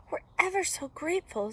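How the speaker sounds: high, gushing thanks; grateful little girl. A narrator's reading. Rescued girl thanking the hunter, gushing. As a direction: pitch it up, brimming with gratitude.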